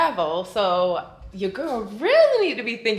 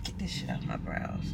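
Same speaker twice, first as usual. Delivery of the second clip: whisper; 10 dB quieter than usual. Real, not acted. Muttered aside.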